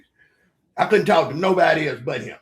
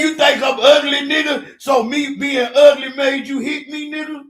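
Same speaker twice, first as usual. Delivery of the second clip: indignant shout; 10 semitones higher, 8 dB louder than usual. Retold or imitated. Ro voiced shouting on call.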